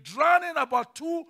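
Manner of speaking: loud, high, drawn-out preacher's cry; dramatic climax. A narrator's reading. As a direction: stretch it out slowly to a shouted peak. Climax of the story read aloud.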